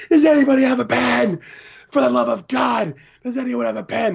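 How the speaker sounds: put-on very high, desperate kid pleading; frantic little boy. Little fan frantic for a pen, very high pleading. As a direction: beg the room like a panicking child. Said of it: "this kid's freaking out".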